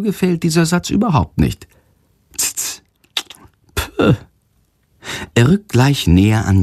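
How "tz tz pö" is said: scoffing tut. Acted. Offended bat scoffs.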